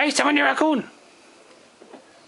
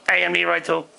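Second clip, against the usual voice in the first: low second voice; 7 semitones lower, faster than usual. Acted. Second Leroy character, low voice.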